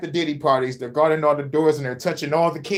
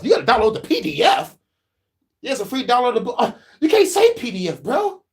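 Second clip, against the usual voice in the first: mock shocked friend; 6 semitones higher than usual.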